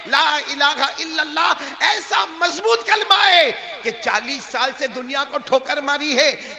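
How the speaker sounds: loud, high fervent declaration; impassioned oath. Acted. Saint swears his faith to the pilgrim, fervent shout. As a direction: proclaim it with full force.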